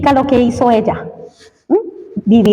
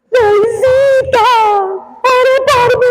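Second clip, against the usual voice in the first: much higher, very slow tearful begging; tearful plea; 11 semitones higher, much slower than usual. Retold or imitated. Weeping classmate begging Luisito to pray for her, high and very slow. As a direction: plead through tears for prayer. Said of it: "con los grandes lagrimones".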